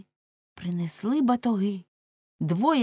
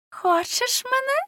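very high, slow come-on; coquettish blonde girl. A narrator's reading. Pretty girl offering herself to Lamme, high coy question. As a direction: offer yourself in a high, lilting voice. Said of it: "сказала вродлива русявочка".

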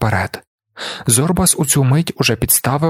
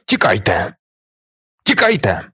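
hoarse shout. A narrator's reading. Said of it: "хрипко вигукнув Зорбас".

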